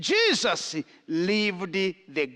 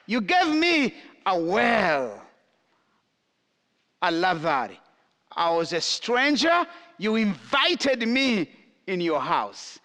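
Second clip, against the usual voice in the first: higher, raised preaching read of the passage; scripture reading; 6 semitones higher than usual. Acted. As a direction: proclaim the verses to the congregation. Reads scripture in heightened voice.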